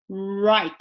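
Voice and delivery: "right" led in by a small puckered "う"; English R. Shown as a demonstration. Teacher demonstrating R for learners, u-led "right". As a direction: start "right" from rounded lips. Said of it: "うから入ります".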